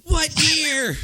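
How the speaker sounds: high, slow, strained mock shout; hammed-up movie quote. Friend quoting a running joke since college, exaggerated yell. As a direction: drag out "year" like a cartoon hero. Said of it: "John and I have been saying".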